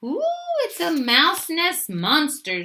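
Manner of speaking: high, slow frightened squeak. A narrator's reading. Little Mouse seeing his reflection in the water, squeaking. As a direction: squeak it in wide-eyed fright. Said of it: "squeaked Little Mouse".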